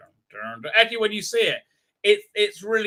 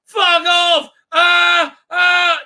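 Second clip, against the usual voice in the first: slow, much higher, louder terrified yell; mock scream; 12 semitones higher, 12 dB louder, much slower than usual. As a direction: yell it out in fake terror. Heroine screams at the statue.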